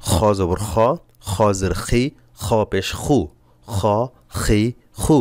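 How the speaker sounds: খ খি খু with a scraping throat sound; rasping kh. Shown as a demonstration. Teacher drilling the letter, rasping খ. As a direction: scrape the back of the throat on each খ. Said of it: "খর খর একটা আওয়াজ হবে".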